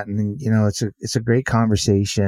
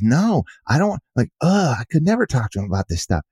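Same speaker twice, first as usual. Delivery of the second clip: higher, frustrated daughter, ending fast; daughter's voice; 6 semitones higher than usual. Retold or imitated. Daughter explaining her silence, higher and frustrated. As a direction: groan at the thought, then rush it out. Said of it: "And she goes".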